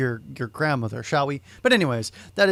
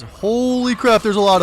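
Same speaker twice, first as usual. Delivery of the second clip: exasperated surprise; 4 semitones higher, 5 dB louder than usual. Real, not acted. Overwhelmed by many enemies.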